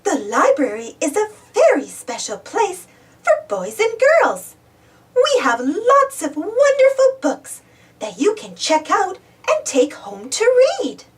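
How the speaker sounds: librarian voice. A narrator's reading. Missus Turner welcoming Baby Bop to the library. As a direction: welcome a little one with a smile. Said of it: "smiles Missus Turner".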